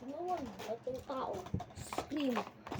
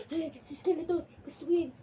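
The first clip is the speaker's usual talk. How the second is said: excited; 6 semitones higher, 7 dB louder than usual. Real, not acted. Finding favourite character.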